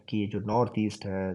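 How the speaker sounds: ordinary delivery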